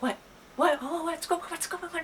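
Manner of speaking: high, soft, squeaky-quiet questions; hushed urgent questioning. Retold or imitated. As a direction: ask urgently without being heard. Whispering outside the boys' door.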